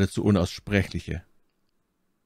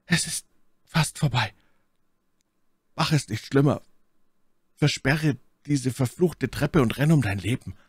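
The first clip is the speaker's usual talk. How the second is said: higher, strained voice of a failing man; weak voice; 5 semitones higher than usual. A narrator's reading. Warren's last farewell to Carter, weak and desperate. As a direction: plead weakly, as if nearly finished. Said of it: "Dann Warrens schwache Stimme".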